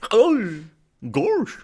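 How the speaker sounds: high cartoon Goofy voice; dopey cartoon dog. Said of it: "sounded a lot like Goofy".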